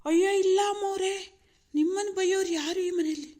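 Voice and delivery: high, loud sarcastic protest; mock deference. Husband mockingly assures madam nobody scolds her, sarcastic. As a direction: exaggerate humble denial to needle her.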